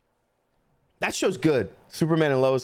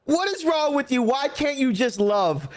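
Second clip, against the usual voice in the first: mock preachy plea; 7 semitones higher than usual.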